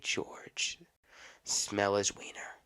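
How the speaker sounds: breathy, slow whisper. Acted. Whispering the shocking part to viewers. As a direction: say it like a dirty secret. Scandalous detail told on podcast.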